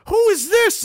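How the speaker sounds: high, startled question; incredulous. Retold or imitated. Wounded soldier asks who the doctor is, incredulous. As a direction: pitch it way up in disbelief.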